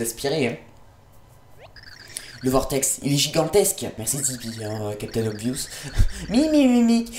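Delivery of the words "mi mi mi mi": mocking cackle. Villain cackle mimicked.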